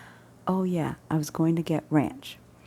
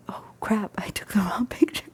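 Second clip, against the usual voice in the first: breathless whisper, higher; whispered panic; 5 semitones higher than usual. Retold or imitated. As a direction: whisper it like a small disaster. Realizing she sent wrong picture.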